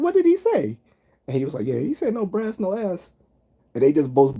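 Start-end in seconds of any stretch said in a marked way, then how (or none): 0.0-0.8 high, eager mock question; curious drill sergeant. Second sergeant asks what soldier said, high eager voice. 1.7-3.1 amused sergeant. Retold or imitated. Sergeant repeating joke amused.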